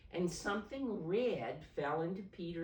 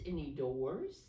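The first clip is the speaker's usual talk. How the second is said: ordinary delivery, nothing marked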